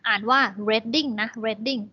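Reading said slowly as RED-ing, short e. Shown as a demonstration. Teacher spells the town name for students, slow RED-ing. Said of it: "สระเอ รอเรือ ดอเด็กนะ".

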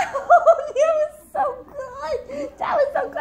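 bursting laugh through the words; giggly tickle attack. Real, not acted. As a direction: laugh out loud while praising. Laughing while tickling baby.

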